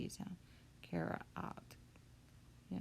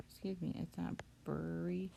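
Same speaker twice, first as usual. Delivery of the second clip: ordinary talk, nothing marked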